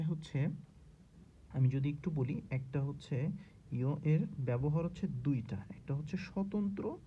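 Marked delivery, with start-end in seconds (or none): 3.7-7.0 whispering. Real, not acted. Teacher explaining.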